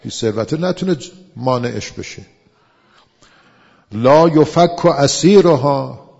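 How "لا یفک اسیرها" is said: slow, loud chanted Arabic; sermon chant of a holy text; scripture recitation. Acted. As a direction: intone the verse like a preacher.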